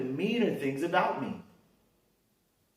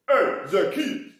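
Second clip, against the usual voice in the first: slow, loud sing-song call; loud drawn-out hail up into the tree; overdone Jesus greeting; 5 semitones higher, 8 dB louder, slower than usual. Retold or imitated. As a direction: ham up the greeting. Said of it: "no, he didn't do that much".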